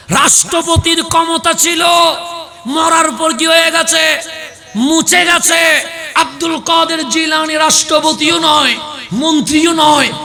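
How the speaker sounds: loud, rousing sermon voice; fiery preaching. Acted. Preacher rousing the gathering about Abdul Qadir Jilani, fiery sermon voice. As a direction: preach it out to the whole crowd. Preacher rising to full force.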